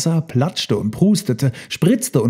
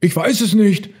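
high, slightly louder elephant voice; puzzled answer; 9 semitones higher, 4 dB louder than usual. A narrator's reading. Confused elephants answer each other, high puzzled voice.

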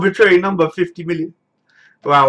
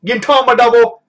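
excited announcement; 6 semitones higher, 5 dB louder than usual. Pretend. Hype announcement after call.

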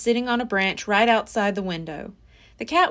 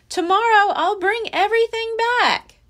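louder and higher announcement; loud declaration to the woods; loudly; 10 semitones higher, 5 dB louder than usual. Acted. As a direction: say it loud for whoever is listening. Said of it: "Jack said loudly".

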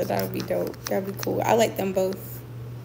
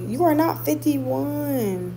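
higher and very slow, stretched in disbelief; drawn-out disbelief; 6 semitones higher, much slower than usual. Real, not acted. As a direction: drag it out, refusing to believe. Disbelief at viewer's age.

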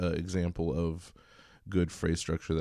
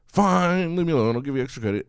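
exasperated giving in; 9 semitones higher, 8 dB louder than usual. Acted. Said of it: "exasperated teacher giving in".

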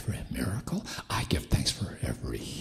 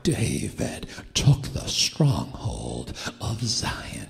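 full-force preaching; 4 dB louder than usual. Real, not acted. Preacher declaring healing to the church, bold and forceful. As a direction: proclaim it boldly, stretching key words.

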